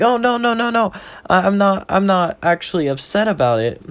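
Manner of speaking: high-pitched, hurried reassurance; high, flustered denial that eases down; flustered girl. Acted. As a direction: blurt it in a high girlish voice. Elsie hurriedly reassures Deku.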